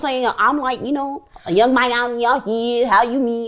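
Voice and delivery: put-on City Girls American accent; bad American accent. Host showing how city girls talk, awful American accent. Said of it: "The city girls are like".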